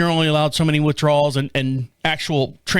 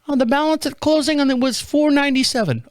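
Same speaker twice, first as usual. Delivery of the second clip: put-on high, girlish rep voice; little girl on phone; 9 semitones higher than usual. Bank rep asking caller to verify, high little-girl voice. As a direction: rattle off verification in a girlish pitch. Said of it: "I get this little girl on the phone".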